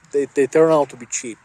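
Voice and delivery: usual speaking voice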